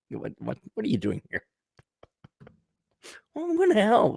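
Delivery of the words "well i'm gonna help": high, bright-voiced friendly Canadian, much higher; chirpy, eager mock Canadian; cheery Canadian pilot. Comic voicing a Canadian pilot joining the fight, cheery and high. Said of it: "get a Canadian in there".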